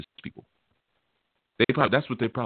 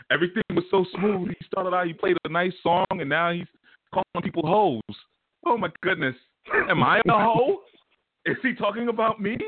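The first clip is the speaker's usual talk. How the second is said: high-pitched, scandalized impression; mock shocked listener; 8 semitones higher than usual. Listener reacting to being called a hoe, mock-shocked high voice. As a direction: pitch it way up, aghast and hammed-up.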